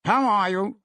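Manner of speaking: a brisk merchant hailing a colleague; businessman greeting. Acted.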